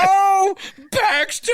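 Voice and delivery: high, slow, wailing cry; Anchorman quote. Retold or imitated. Host reenacting the Baxter bridge scene, high wailing cry. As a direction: wail it like the dog just got kicked off the bridge.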